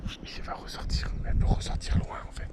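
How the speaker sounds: souffle, sans voix; chuchotement en se cachant. Real, not acted. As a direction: chuchote pour ne pas effrayer la loutre. Consignes chuchotées aux enfants. (chuchoté, caché près de l'eau)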